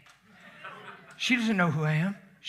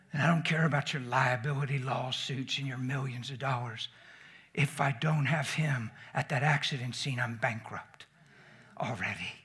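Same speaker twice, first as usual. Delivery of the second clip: softer, lower earnest conviction; sincere; 5 semitones lower, 5 dB quieter than usual. Real, not acted. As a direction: say it quietly from the heart. Quiet sincere sermon point.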